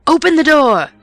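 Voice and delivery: loud high angry shout; man shouting. A narrator's reading. Angry man outside shouts at the locked door. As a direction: bellow it through the door. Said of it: "it was shouting".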